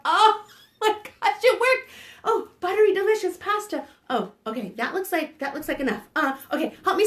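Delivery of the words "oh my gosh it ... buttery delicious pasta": high, loud delighted squeal with a laugh; thrilled it worked; excited. Acted. Thrilled the pasta pot worked for the kids.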